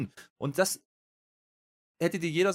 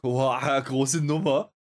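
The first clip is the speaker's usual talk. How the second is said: a louder, hammed-up boah of fake amazement; mock awe; 5 dB louder, much slower than usual. Mocking what everyone thought of the new arrivals, sarcastic awe. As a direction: sell it as a fake big moment. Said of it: "da haben ja alle gedacht".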